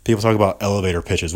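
usual speaking voice

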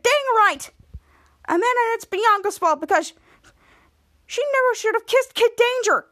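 high-pitched indignant rant; acted anger. Henry venting to Charlotte about Bianca, mad. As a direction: blurt the grievance, voice pushed up high. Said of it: "if you're this mad at Bianca".

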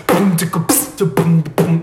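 low mouth-made hip hop beat; beatbox imitation. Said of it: "mi hip hop acá". (imitando un ritmo de hip hop)

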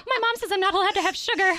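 put-on high, fast, laughing child voice; laughing mock kid. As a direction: giggle through a bratty kid line. Mocking kids' rule, laughing.

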